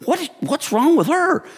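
a startled squeak of confusion; mock bewilderment. Preacher watching a lady flop on the floor, slow high bewilderment.

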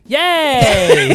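high, loud mock-cheer yay. Picturing Seth on his couch, mocking with a bright yay. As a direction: cheer it like a fake fan. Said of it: "I can see Seth sitting on his couch".

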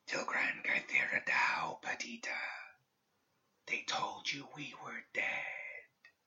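slow, breathless whisper from far off; ghostly whisper. A narrator's reading. Dead student's voice reaching Perdita's mind, ghostly whisper. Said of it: "it whispered".

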